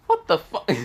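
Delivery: high stunned outburst, then a laugh; dumbfounded. Acted. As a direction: caught off guard, blurting it high. Dumbfounded at girls on couch.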